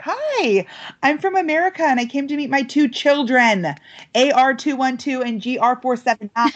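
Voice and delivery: high, overeager mock greeting; giddy tourist hello; jacked-up greeting. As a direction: play the frazzled eager American. Jet-lagged self greeting kids.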